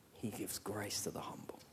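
hushed whisper of grace. Real, not acted. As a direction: breathe it like a secret. Whispered contrast line.

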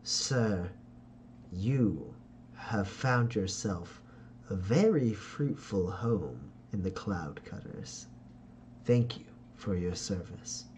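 smooth, courtly schemer, slow and deliberate; scheming noble father. Acted. Ferdinand greets his son's companion in a courtly, flattering voice. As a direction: lay on the flattery like a silver-tongued lord.

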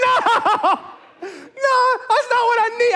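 laughing protest at the friend's bad news; laughing panic. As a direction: protest with a laugh, half desperate.

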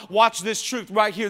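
ordinary delivery

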